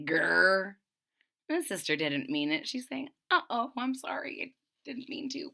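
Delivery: plain reading voice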